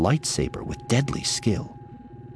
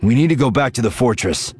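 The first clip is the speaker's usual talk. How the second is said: urgent, higher and louder burst; blurted; 6 semitones higher, 6 dB louder, faster than usual. A narrator's reading. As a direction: blurt it out without preamble. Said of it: "he blurted".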